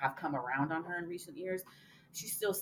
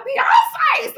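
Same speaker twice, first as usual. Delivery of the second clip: high, very loud fan shout; excited yell; 17 dB louder than usual. Retold or imitated. Retelling the moment the Oscars came on her phone, excited yell. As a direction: shriek the name in thrilled recognition. Retold yell on seeing the show.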